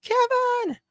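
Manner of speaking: high shrieking "Kevin!"; frantic high yell of "Kevin!"; panicked yell. Retold or imitated. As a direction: scream the name like Home Alone parents. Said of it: "where they go".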